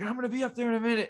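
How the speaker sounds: rapid, high threat in dad's voice; mock dad yelling. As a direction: rattle it off like a freaked-out dad. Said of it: "freaking out, like, yelling".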